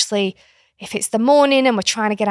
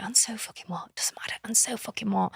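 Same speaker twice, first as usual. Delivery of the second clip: very soft whispered mantra to self; 11 dB quieter than usual. Real, not acted. Mother calming herself before confronting son, soft whispered mantra. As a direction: whisper it to calm yourself down. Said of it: "say the mantra".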